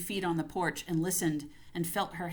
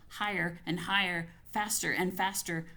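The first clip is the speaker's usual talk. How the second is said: ordinary delivery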